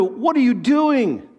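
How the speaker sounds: mild holler, stretched out slowly; retold holler. Father calling to little son from upstairs window, retold holler. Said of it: "I holler out the window".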